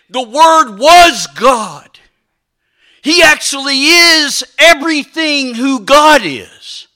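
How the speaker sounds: loud, weighty pulpit declaration; emphatic preaching. Real, not acted. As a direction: drive each word home to the congregation. Preacher declares climax of point.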